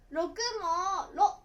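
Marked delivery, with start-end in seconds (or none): 1.1-1.4 六 cut short to "ro'" with a stop; shortened ro'. Shown as a demonstration. Teacher showing how 六 changes, a cut-off ro'. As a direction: stop sharply after "ro" for 六. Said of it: "ろと読みます".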